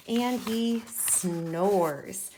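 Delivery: "and he snores" drawn out very slowly; slow dictation. Shown as a demonstration. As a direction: stretch the words as if writing them. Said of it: "when Marley sleeps".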